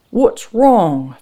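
loud, high-pitched question; agitated demand. A narrator's reading. Frank looking his wife over, loud and agitated. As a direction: demand it with veins popping.

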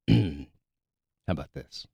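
very low, hushed seduction attempt; bedroom voice. Shown as a demonstration. Host demonstrating another bedroom voice to his co-host, low and hushed. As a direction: drop the pitch and volume for a sexy try. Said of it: "just, like, lower".

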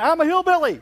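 put-on high, loud and proud little girl; a child's cheerful announcement, pitched high and loud; proud child's answer. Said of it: "She raises her hand".

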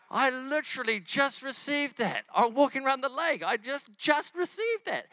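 high, drawn-out excited retelling; amazed outburst. Retold or imitated. Preacher retells his amazement at what his friend received, excited. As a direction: stretch it out in high-pitched disbelief.

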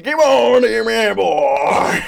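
loud, high drawn-out cheer; mock fan holler. Imitating football fans to co-host, long loud holler. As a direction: bellow it like a rowdy supporter. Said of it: "I love that, just, like".

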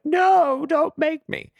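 high, loud whiny "no"; whiny refusal. Retold or imitated. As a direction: beg not to be made to. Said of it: "And I'm like".

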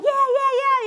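high, loud happy yelling; excited yeah. Retold or imitated. Runner answering cheering friends, loud and elated. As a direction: yell back in the thrill of the race. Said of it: "And I'm like".

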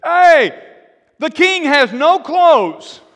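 loud, high yell from the crowd; boy yelling out. Acted. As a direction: shout it out high for all the crowd to hear. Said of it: "he yells out the truth".

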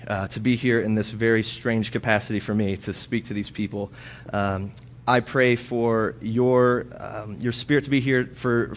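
soft, quiet prayer voice. Acted. Pastor praying before the scripture reading, hushed and soft. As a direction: pray humbly to God before the sermon.

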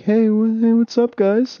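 high-voiced retold hello over game chat; retold friendly greeting. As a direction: call out high, imitating a hello to strangers.

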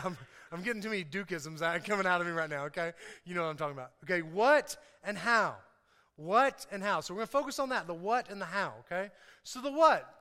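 usual speaking voice

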